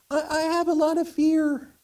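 timid high-pitched candle; little voice. Acted. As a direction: confess it in a tiny, very high voice. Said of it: "this little voice say".

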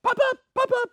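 little ones shouting to parents from the rides; excited grandkids. Retold or imitated. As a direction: squeal it like a kid on a fair ride begging to be watched. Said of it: "and they're all going".

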